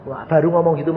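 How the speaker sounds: ordinary delivery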